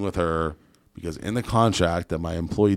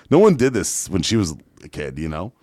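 ordinary talk, nothing marked

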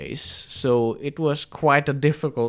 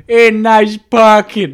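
loud, slow, high sarcastic Brooklyn jab; mock Brooklyn sneer; 9 semitones higher, 12 dB louder, slower than usual. Angry driver mocks her parking, loud slow Brooklyn sneer. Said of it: "It was just like a".

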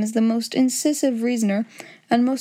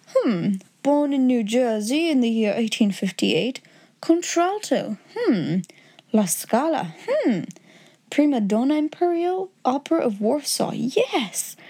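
musing; 3 semitones higher than usual. Acted. Holmes scanning his index entry, musing with lively pitch swoops. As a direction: read out notes, reacting between each one.

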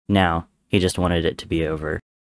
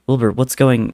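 higher, louder desperate plea; begging; 6 semitones higher, 5 dB louder than usual. Acted. Tommy pleading with his brother, frantic. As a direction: beg him to stop, voice rising. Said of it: "Tommy begged".

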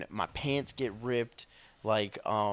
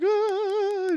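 imitated scream; 8 dB louder than usual. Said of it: "just, like, screaming".

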